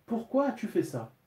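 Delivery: elle découvre le vomi, stupéfaite; surprise. A narrator's reading. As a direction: question haute et étonnée. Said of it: "Une expression de surprise". (Mildred surprise devant le sol sali, voix bien plus aiguë)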